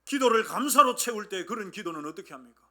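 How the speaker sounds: ordinary talk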